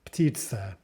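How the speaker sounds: "Ptitsa" as ptit-sa, no vowel after P; two syllables. Shown as a demonstration. Teacher confirming two syllables, tight P-T cluster. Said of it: "ergo two syllables".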